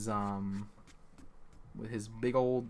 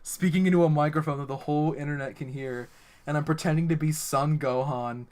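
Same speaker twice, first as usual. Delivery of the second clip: raised voice, easing as it goes; loud exasperated realization; 3 semitones higher, 10 dB louder than usual. Real, not acted. Game master taking stock of his life, loud exasperation. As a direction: declare it loudly in comic dismay. Dismayed outburst at his own absurd act.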